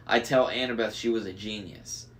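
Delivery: ordinary delivery, nothing marked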